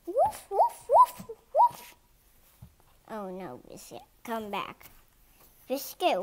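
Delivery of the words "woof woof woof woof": high, loud rapid yapping; excited puppy barking. Acted. Puppy chases the bird, excited high barking. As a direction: bark like a puppy chasing a bird.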